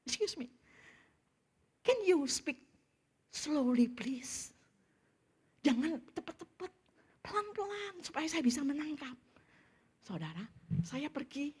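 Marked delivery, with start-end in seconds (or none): 0.1-9.2 politely plead, drawing out the English; unabashed polite request. Retold or imitated. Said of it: "tidak malu-malu saya bilang gini".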